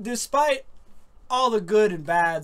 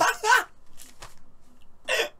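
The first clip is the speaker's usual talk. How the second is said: high laugh. Real, not acted. Laughing after surprise.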